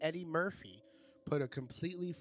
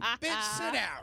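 comic yell; 6 semitones higher, slower than usual. Retold or imitated. Comic Chris Rock Jigsaw riff.

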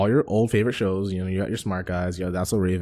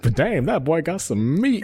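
higher, slow Southern drawl imitating [name]; [name]'s Southern voice; 7 semitones higher, slower than usual. Replaying the [name] sound clip for his cohost in a higher, slow Southern drawl. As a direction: stretch out meat in a mock Southern drawl. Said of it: "the sound clip of [name] going like".

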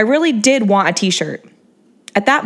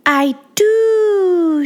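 cooing; 11 semitones higher than usual. A narrator's reading. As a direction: coo it up high. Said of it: "she cooed in a higher pitch".

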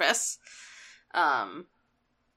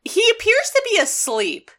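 much higher, a deadpan report in a put-on voice; high Ironhide voice; 12 semitones higher than usual. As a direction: play it as a nervous robot sidekick.

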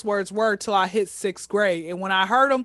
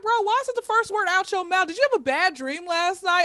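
incredulous; 8 semitones higher than usual. Retold or imitated. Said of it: "people wake up like".

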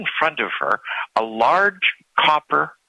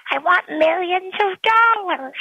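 put-on much higher young girl voice; greedy little girl asking. A narrator's reading. Girl answers the genie.

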